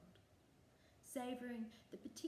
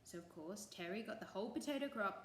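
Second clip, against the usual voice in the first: plain reading voice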